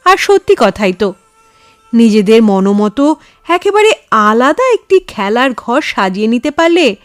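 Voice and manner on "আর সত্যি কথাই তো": ordinary delivery, nothing marked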